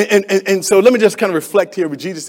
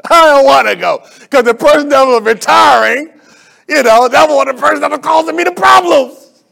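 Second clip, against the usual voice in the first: loud, high, indignant outburst; heated shout; 8 semitones higher, 9 dB louder than usual. Real, not acted. Preacher shouting his grudge to the church. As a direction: belt it out, still fuming.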